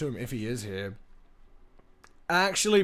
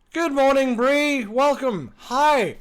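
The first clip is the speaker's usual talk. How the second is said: much higher and louder, bursting with cheer; light up and greet her warmly; excited greeting; 11 semitones higher, 8 dB louder, much slower than usual. Real, not acted. Streamer greets a viewer arriving in chat, excited high greeting.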